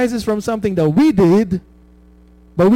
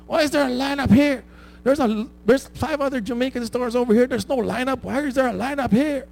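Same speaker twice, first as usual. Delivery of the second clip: imitating angry customer; 7 semitones higher than usual. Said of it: "There's this guy that walks in, angry".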